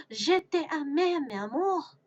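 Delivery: gasp, then high, swooning French; dramatic love declaration. Acted.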